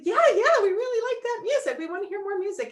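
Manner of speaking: high, loud eager yes yes. Retold or imitated. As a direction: sound thrilled and keen.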